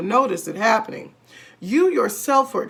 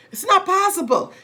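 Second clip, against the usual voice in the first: much higher, loud denial; shrill protest; 11 semitones higher, 4 dB louder than usual. A narrator's reading. Wife protesting she can't be shrinking. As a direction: refuse it, panicking.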